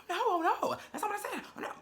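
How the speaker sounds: high, whiny mocking squeal of panic; mock whiny Oliver. Mocking Oliver as he backs off on the show, high whiny squeal. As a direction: parody him backing down in a squeaky panic. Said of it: "it's like".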